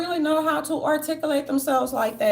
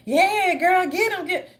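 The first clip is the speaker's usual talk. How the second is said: mock cheering; 5 semitones higher, 3 dB louder, slower than usual. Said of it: "Y'all real, real, right".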